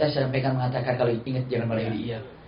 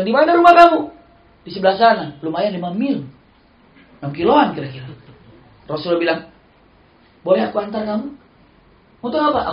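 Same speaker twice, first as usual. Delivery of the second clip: high, loud exchange; acted dialogue; 7 semitones higher, 8 dB louder than usual. Preacher acting the Prophet asking the slave, high and loud. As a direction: play both voices brightly.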